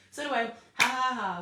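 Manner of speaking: high, loud ha ha ha; real giggles over the words; laughing. Real, not acted. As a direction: laugh it off and push on. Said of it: "I don't know why I'm laughing".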